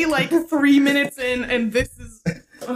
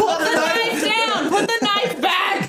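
mock alarm; 8 semitones higher than usual.

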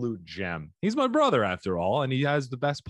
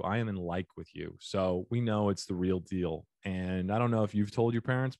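ordinary delivery

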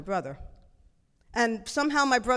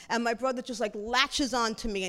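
plain reading voice

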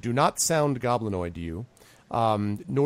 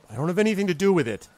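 higher-pitched protest, passing the blame; defensive horseman; 5 semitones higher, 5 dB louder than usual. Acted. Horseman answers the accusing player, defensive. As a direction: plead it's not your doing, voice lifted.

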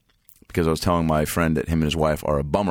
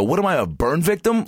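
higher, incredulous retort; retold indignation; 11 semitones higher than usual. Customer answering barber's reassurance, retold indignation. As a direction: throw back an offended rhetorical question.

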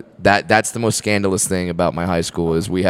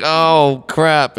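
high-pitched alarmed realization; mock dismay; 9 semitones higher than usual. Time traveler seeing the worse outcome, mock dismay.

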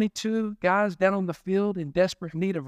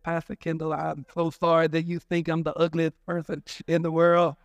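mock lisping voicemail message; toothless caller. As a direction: imitate a hurt caller with no teeth. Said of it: "didn't have any teeth and they talk like this".

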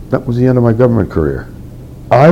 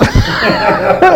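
a high, fond burst of laughter; real laugh. Real, not acted. Recalling his sweet mother's question, real high laugh. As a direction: laugh it out, touched.

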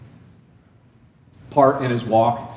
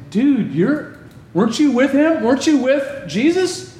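accusing bystander; 10 semitones higher than usual. Acted. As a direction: call him out in disbelief.

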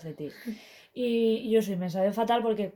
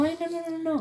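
panicked; 7 semitones higher, faster than usual. Retold or imitated. Reenacting panic while playing.

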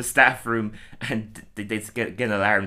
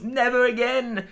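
much higher, slow dramatic farewell; stilted old movie melodrama; 13 semitones higher, slower than usual. Retold or imitated. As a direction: drag it out like a fifties heroine. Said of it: "she goes".

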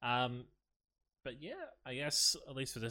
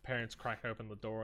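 ordinary talk

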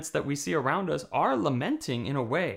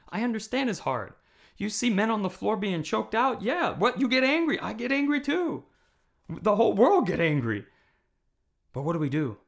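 higher and louder, building fervour; fiery sermon climax on anger; impassioned preaching; 8 semitones higher, 6 dB louder than usual. Real, not acted. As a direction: preach it with rising passion.

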